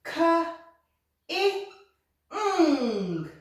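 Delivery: K, I, ng spaced, I up high; sounding out. Shown as a demonstration. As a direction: blend letters slowly for kids. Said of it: "Listen carefully".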